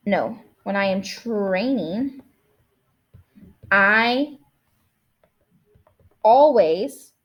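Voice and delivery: slow, halting, thinking aloud; drafting a sentence slowly. Shown as a demonstration. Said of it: "listen to how I think through".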